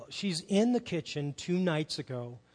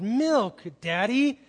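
put-on louder and higher, a cross toddler demanding milk; unhappy demand; 5 semitones higher, 6 dB louder, much slower than usual. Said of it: "She's not happy".

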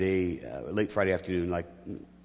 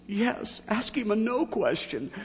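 much higher, teasing voice for the sister-in-law; mimicked sister-in-law; slower than usual. Wife's sister quipping at the family bedside, high mimicking voice. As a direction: pitch it way up to play the wife's sister. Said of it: "my wife's sister looks at me and says".

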